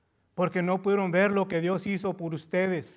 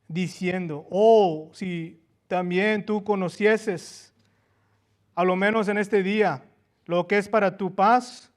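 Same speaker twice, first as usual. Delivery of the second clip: loud, fervent reading of the lament; impassioned scripture reading; 6 dB louder than usual. Acted. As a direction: cry out the prophecy over the city. Reads Jesus' lament loudly. (lectura bíblica fervorosa y en voz alta)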